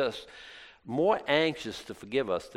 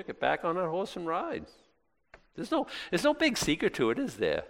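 normal talking voice

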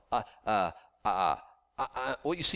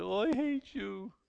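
mock whiny complaint; 9 semitones higher, slower than usual. Mocking petty complaint to God.

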